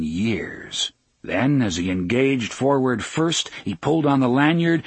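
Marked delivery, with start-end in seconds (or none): none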